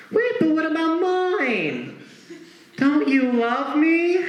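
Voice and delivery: put-on a hurt kid begging for praise; needy little kid. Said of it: "he's like".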